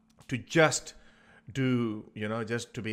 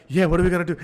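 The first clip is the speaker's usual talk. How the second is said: louder, eager group chatter; loud eager bros; 6 semitones higher, 10 dB louder, much faster than usual. Retold or imitated. Boys plotting together, loud and eager. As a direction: egg each other on with noisy glee. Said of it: "So they're like".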